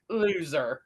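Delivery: lightly voiced canned PA call; a hint of a deadpan arena announcer; imitating WCW announcer voice. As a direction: toss out a drawn-out mock announcement. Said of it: "in the W C W voice".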